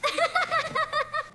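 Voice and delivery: high squeal of a giggle. Real, not acted. Baby giggling after the powder sneeze, squealing.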